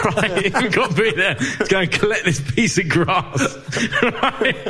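retelling dad's words while laughing, pitch up high; laughing through quote. Retold or imitated. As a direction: giggle your way through the quote. Dad's quote retold amid laughter.

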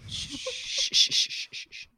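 string of hissed shushes, tapering off; repeated shushing. Acted. Hushing San Francisco friends who moan about mild heat, mock shushing. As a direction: hush them over and over.

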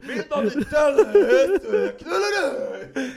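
very high, loud cry breaking into laughter; mock bedroom shout. Showing his friend how it should sound in bed, laughing high shriek. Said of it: "Ska vara så här".

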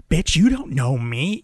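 loud, drawn-out challenge; imitating a woman picking a fight; confrontational. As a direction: slowly square up to a stranger. Acted bus quarrel, woman confronts.

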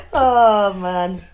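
normal talking voice

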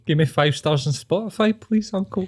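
mock plea. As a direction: beg the uncle sweetly. Mock pleading to threatening uncle.

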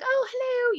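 sing-song high hello; bright high-pitched surprise; high-pitched greeting. Retold or imitated. Retelling meeting a child fan.

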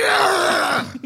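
high mock scream of pain; a sudden high yelp as the legs are crushed; witch scream. Player voicing the dying witch as her legs are crushed, high scream. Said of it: "Then stomach is like".

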